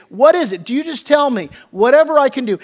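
loud, high, urgent voicing of a plea; emphatic petition. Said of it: "emphatic, you know petition of them".

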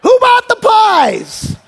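imitated high-pitched outraged shriek; hammed-up screaming customer at the counter; woman losing it. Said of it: "he heard the lady losing it".

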